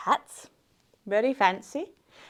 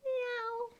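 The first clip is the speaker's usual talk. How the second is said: very soft, high meow; a tiny timid mew; shy cat; 11 semitones higher, 15 dB quieter than usual. Acted. Storyteller shows children a shy cat, tiny soft meow. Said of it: "Can I hear the shy cats?".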